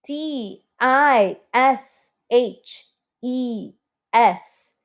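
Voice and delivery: slow, letter-by-letter spelling aloud. Shown as a demonstration. Teacher spelling always for the class, slow letter by letter. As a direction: space out each letter for the class. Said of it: "let's spell it now".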